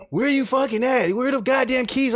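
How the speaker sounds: high mock angry yell; angry superior. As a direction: demand the keys in a high shout.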